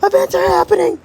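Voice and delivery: very high frantic cry; high-pitched escalating panic; panicked squeal. Acted. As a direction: squeal it in mounting alarm. Panic as time shifts, escalated.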